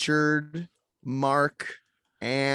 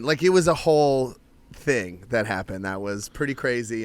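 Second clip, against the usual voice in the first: normal talking voice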